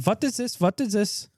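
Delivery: a lightly put-on foreign doctor; mock Indian doctor. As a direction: ask it like a baffled surgeon. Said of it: "somebody goes".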